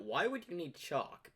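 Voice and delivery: usual speaking voice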